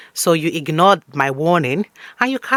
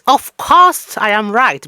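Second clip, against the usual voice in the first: slow, loud, smug mom; smug mother; 5 semitones higher, 5 dB louder, much slower than usual. Acted. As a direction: drag it out triumphantly. Mom asserting after being proven right.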